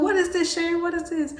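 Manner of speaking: high-pitched mock questioning mother; playing mom, high and nosy; curious mom. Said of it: "She's like".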